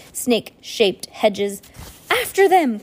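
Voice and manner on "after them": loud, high rallying cry; shouted. A narrator's reading. Klaus to his sisters as villains flee, urgent shout. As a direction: shout it like starting a chase. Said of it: "Klaus shouted".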